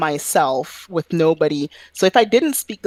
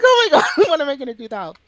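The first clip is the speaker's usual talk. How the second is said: laughing panic; 9 semitones higher, much faster than usual. Retold or imitated. Panicked quote while laughing.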